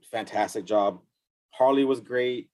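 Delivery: ordinary talk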